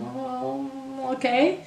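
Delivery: put-on a kid half-heartedly giving in to a hug; reluctant child. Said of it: "the other kid is kind of".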